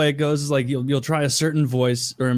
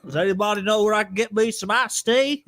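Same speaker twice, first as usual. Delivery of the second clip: higher put-on Southern accent; 7 semitones higher than usual. Cast member joining the accent bit, Southern accent. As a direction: ask in a hammed-up Southern accent.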